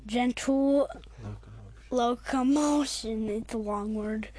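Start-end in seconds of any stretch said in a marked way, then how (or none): none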